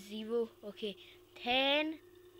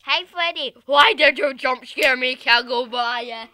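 loud laughing protest; loud mock complaint; 17 dB louder than usual. Real, not acted. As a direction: scold the monster playfully. Laughing complaint after jump scare.

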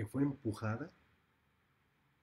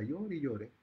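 ordinary delivery

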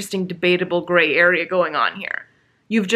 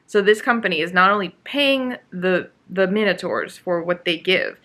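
normal talking voice

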